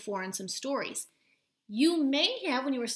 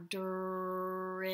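slow stretched word; 3 semitones lower, 3 dB quieter than usual. Shown as a demonstration. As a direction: say "drip" slowly, stretching every sound. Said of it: "two consonants working together".

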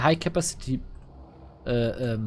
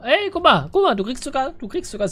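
excited; 10 semitones higher, 5 dB louder than usual. Real, not acted. Spots big arena reward.

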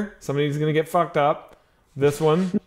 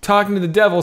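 ordinary talk, nothing marked

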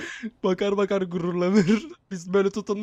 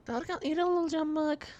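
man doing a woman's voice; 7 semitones higher, 9 dB quieter than usual. Pretend. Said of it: "Kadın sesi yapacaktım".

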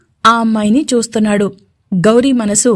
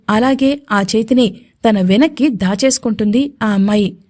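usual speaking voice

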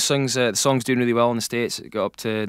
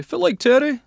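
high, loud mock American-accented Scots; imitated American accents; 14 semitones higher, 5 dB louder than usual. TV producers greeting the singer in the morning, imitated American accent. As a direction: mimic Americans attempting a Scottish greeting, pitched high. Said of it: "With their American accents".

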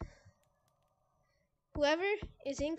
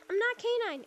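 higher-pitched trolling voice; high mock-innocent troll; K nine; 5 semitones higher than usual. Shown as a demonstration. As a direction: do a troll's pleading denial, pitched up. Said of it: "I can do one of his, like, trolling".

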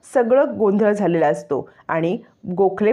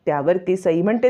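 ordinary delivery, nothing marked

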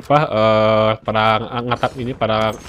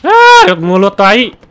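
frustrated yell; 7 semitones higher, 10 dB louder, much slower than usual. Real, not acted. Frustrated gamer curses loudly.

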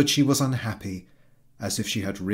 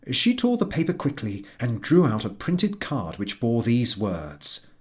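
plain reading voice

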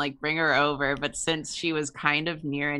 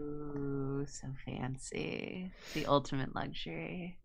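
mock awe; 12 dB quieter than usual. Teasing about walking distance.

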